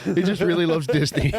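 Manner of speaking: laughing high and bright. Real, not acted. Guest joking about his own Disney trip, laughing. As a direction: laugh through the joke.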